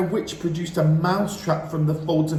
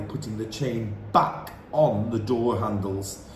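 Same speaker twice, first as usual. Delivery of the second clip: plain reading voice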